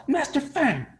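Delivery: high excited call to the master; lightly hammed eager monk calling out. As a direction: call his name up high, bursting to share.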